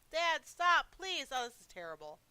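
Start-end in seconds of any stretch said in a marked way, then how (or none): 0.1-1.3 much higher, loud plea; scared child, much higher; child pleading. Acted. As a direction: beg the father to stop.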